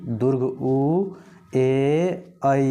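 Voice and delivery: दीर्घ उ, ए, ऐ stretched slowly one by one; teacher stretching the long vowels. Shown as a demonstration. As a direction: draw out each long vowel deliberately. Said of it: "जोर देना पड़ता है".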